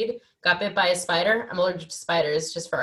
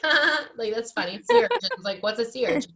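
high, airy dismissive laughing; mock carefree laugh; 6 semitones higher, 3 dB louder than usual. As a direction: brush it off like a clueless tourist. Mocking her own careless laugh.

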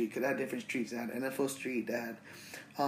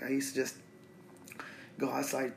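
usual speaking voice

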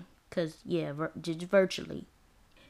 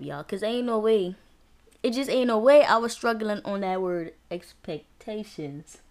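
ordinary talk

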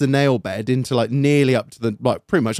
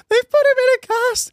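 much higher mock mum voice; imitating mum. Mum repeating to the doctor, high pitched. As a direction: pipe it like his mum.

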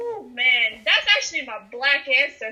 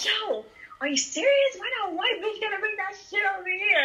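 higher, whiny mock-tearful complaint; hammed-up movie reenactment; 5 semitones higher, 3 dB quieter than usual. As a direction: reenact the scared movie girl, half crying. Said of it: "And she's like".

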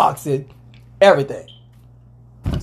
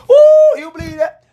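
high, loud laughing whoop; excited whoop; 12 semitones higher, 7 dB louder than usual. Real, not acted. Host thrilled to be mentioned, laughing whoop. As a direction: whoop in delight.

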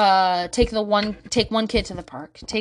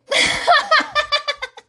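high rapid cackle; loud high giggle burst; real laugh; 5 dB louder than usual. Real, not acted. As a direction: crack up helplessly. Laughs at her own story.

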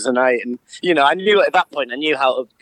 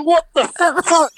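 shocked; 13 semitones higher, slower than usual. Retold or imitated. Shocked at door.

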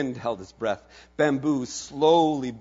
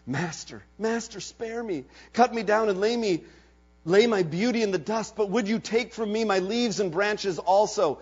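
pleading; 8 semitones higher than usual. Acted. Bamboo pleads for his leaves.

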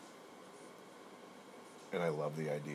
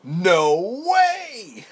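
shrieked high, very loud mock disbelief; 20 dB louder than usual. Imitating stunned friends at the table, shrieking disbelief. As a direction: squeal it like a shocked friend. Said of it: "imagined friends react".

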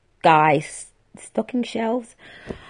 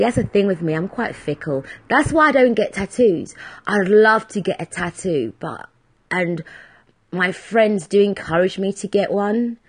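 usual speaking voice

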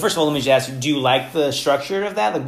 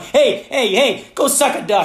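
higher, louder mock telling-off of the kids; hammed-up playground rebuke; mock scolding; 9 semitones higher, 4 dB louder, slower than usual. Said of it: "I'm like".

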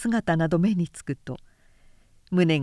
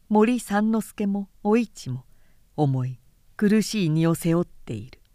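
ordinary delivery, nothing marked

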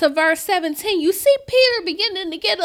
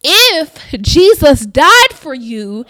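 fiery preaching; 7 dB louder than usual. Acted. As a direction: preach it full force to the church. Preaching at full force.

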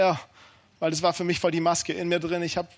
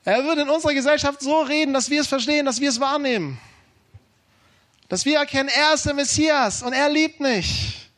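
high, ringing proclamation to a congregation; fervent preaching; 5 semitones higher than usual. Real, not acted. Preacher declares to congregation that he is the Messiah, fervent preaching. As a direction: proclaim it with full conviction, pitched up.

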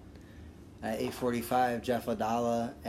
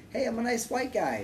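nice guy voice; 11 semitones higher than usual. Retold or imitated. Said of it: "a regular relaxed".

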